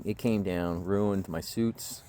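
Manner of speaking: ordinary talk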